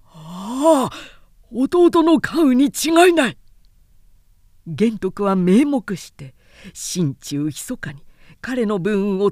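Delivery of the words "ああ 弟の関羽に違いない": higher, louder cry of recognition; joyful recognition. Acted. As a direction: burst out on seeing a lost brother. Xuande recognizes his brother.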